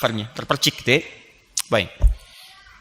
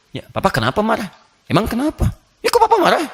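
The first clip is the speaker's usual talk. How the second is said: wife voiced; 7 semitones higher than usual. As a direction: question the husband, getting shriller. Acts wife questioning angry husband.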